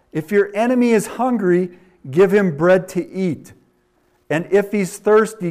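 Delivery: loud, ringing scripture reading; booming pulpit reading; preaching at full force. Acted. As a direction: proclaim it to the congregation. Reads proverb at full force.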